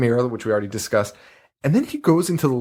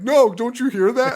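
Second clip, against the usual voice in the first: high mock daughter; 12 semitones higher than usual. Mocking the daughter pleading.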